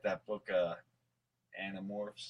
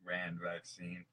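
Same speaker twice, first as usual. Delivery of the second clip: ordinary talk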